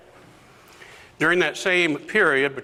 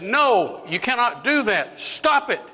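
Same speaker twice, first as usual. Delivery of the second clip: high-pitched insistent objection; acted urgent refusal; vehement protest; 10 semitones higher than usual. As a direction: forbid it, climbing to an outcry.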